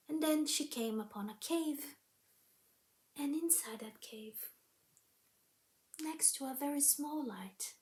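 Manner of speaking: plain reading voice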